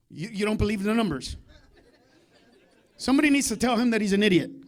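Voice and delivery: normal talking voice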